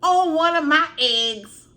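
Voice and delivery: a mother whose egg was stepped on; hammed-up angry mom; mama dragon fury. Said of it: "she over there looking like".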